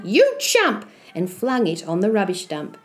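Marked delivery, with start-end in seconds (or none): 0.0-0.8 high, mock-angry yell; yelled insult. Mother insulting her foolish son, high mock yell. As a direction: throw it out as a high scolding cry. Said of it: "she yelled".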